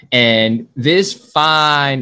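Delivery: fine drawn out very slowly; stress on fine. Shown as a demonstration. Host stretches "fine" to tease his guest, slow emphasis. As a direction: lean on "fine" and stretch it long. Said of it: "and I say emphasis on fine".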